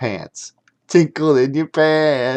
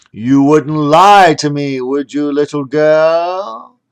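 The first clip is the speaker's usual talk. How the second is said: slow, louder, looming question; menacing wizard; 3 semitones higher, 8 dB louder, slower than usual. Acted. Mister Magic pressing the girl he caught spying, slow menacing. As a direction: lean in and draw it out to scare her. Wizard interrogating suspected spy.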